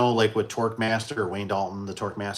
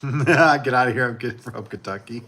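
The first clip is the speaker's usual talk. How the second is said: normal talking voice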